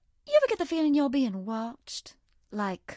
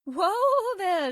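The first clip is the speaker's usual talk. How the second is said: surprised whoa; 7 semitones higher, 4 dB louder than usual. Acted. Reacting to rushing player.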